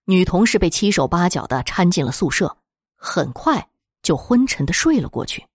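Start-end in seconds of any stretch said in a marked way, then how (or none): none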